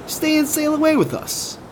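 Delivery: very high, eager invitation; amazed. A narrator's reading. Captain invites the speedy monkey along, amazed. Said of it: "The captain was absolutely amazed".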